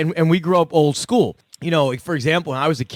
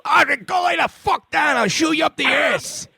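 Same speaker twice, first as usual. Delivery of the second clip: sudden furious shout, much higher; dad snapping; 11 semitones higher than usual. Retold or imitated. Dad snapping at jumping puppies, furious high shout. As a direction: explode at the dogs in a rage. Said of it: "he goes from being".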